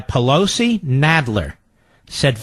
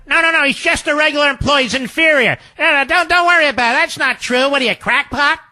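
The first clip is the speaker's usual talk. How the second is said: high, agitated brush-off of an opposing argument; sarcastically acting out an indignant denial; mock dismissive rebuttal; 7 semitones higher than usual. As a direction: wave the objection away, pitched high and insistent. Mock courtroom rebuttal of lawyers' argument.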